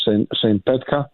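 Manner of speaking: usual speaking voice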